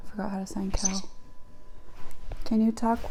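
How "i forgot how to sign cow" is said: whispered aside, much softer. Real, not acted. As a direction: say it under your breath to yourself.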